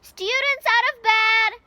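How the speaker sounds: bellowed. A narrator's reading. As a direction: bellow it down the halls to raise the alarm. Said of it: "Peeves bellowed".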